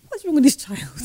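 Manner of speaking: high, incredulous inner thought; baffled mother's private despair; disbelieving high exclamation; exasperated. Retold or imitated. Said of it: "I was having a meltdown".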